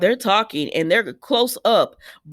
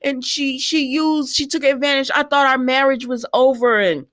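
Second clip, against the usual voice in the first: whiny excuse; 5 semitones higher than usual. Retold or imitated. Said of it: "he tried to blame it on Esme".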